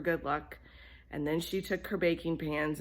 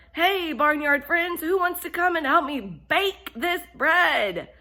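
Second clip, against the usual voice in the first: yelling; 11 semitones higher, 9 dB louder than usual. Acted. Hen yells out the window to her friends, loud and high. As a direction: shout it across the yard. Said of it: "out the window she yelled".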